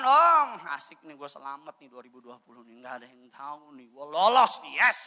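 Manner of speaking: gloating to oneself, bursting out at the start and end; mock gleeful relief. Sinner glad nobody found out, mock gleeful relief. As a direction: play the sinner celebrating a lucky escape.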